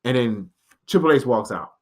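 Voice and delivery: ordinary talk, nothing marked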